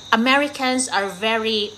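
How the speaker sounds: ordinary talk, nothing marked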